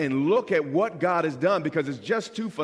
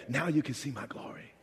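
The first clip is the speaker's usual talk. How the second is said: sudden hushed whisper of Jesus; breathy whispered promise; 8 dB quieter than usual. Acted. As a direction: drop to a secret whisper. Jesus quoted, dropped to whisper.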